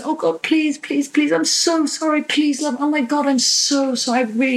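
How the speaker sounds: high, desperate begging voice; pleading. Acted. Therapist voices the hurt child pleading for forgiveness, high-pitched begging. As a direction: beg and apologise over and over, pitched high. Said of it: "I might plead".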